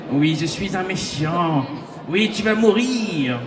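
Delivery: mock slow villain drawl. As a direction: ham up a cheap bad guy. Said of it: "une voix de méchant".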